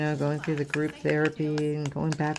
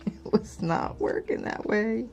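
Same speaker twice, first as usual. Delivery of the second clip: laughing; 6 semitones higher than usual. Real, not acted. Laughs at her own mistake.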